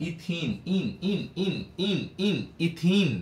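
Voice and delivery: ইথিন with the ending ইন repeated crisply; sing-song drill on ইন. Shown as a demonstration. Teacher shows students the pronunciation, drilling the ending. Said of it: "তার উচ্চারণটা কি হইতেছে দেখো তো".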